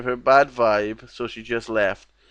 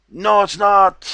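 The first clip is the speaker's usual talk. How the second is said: louder, slower, higher no; emphatic denial; 7 semitones higher, 6 dB louder, slower than usual. Real, not acted. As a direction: drag it out, louder and firmer. Scoffing at the claim, second take.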